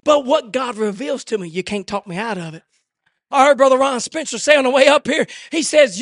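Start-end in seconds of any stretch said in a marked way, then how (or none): none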